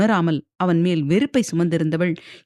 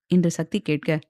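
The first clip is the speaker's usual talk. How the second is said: usual speaking voice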